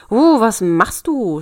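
loud, drawn-out indignant yell; storybook devil's cross outburst; angry cry. A narrator's reading. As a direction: howl it slowly in protest. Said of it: "schrie der Teufel zornig".

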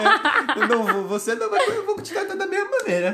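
high, laughing as he talks. Real, not acted. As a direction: laugh through the words. Laughing reply.

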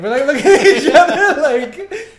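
laughing. Real, not acted. Awkward crush memory, laughing.

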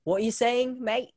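high-pitched put-on Aussie accent; playful Aussie questioning. Shown as a demonstration. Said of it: "Can you try?".

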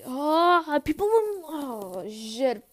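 exasperated. Real, not acted. Reacting to whole grade seeing.

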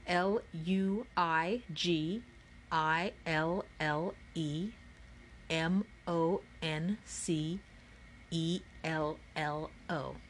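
slow, evenly spaced letters; spelled out slowly. A narrator's reading. Narrator reading the letter grid to listeners, slow and measured. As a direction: read each letter separately and deliberately.

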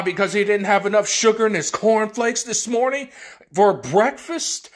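ordinary talk

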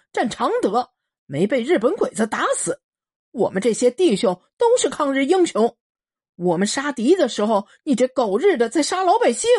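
提高音调怒吼痛骂; 满腔愤恨高声质问; 愤怒大喊. Acted. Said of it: "大个子囚徒大喊". (老兵愤怒大喊)

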